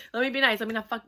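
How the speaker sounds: loud, emphatic self-reminder; mock resolve. Real, not acted. Telling herself to be nice to him, emphatic. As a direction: declare it firmly to yourself.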